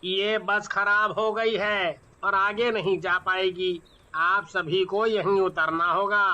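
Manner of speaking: low driver telling passengers to get off; bus driver. Acted. Driver announces breakdown.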